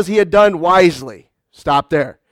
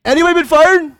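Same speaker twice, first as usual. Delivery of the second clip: calling out to the room; 8 semitones higher, 4 dB louder, faster than usual. Shown as a demonstration. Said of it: "Anybody been fired this morning?".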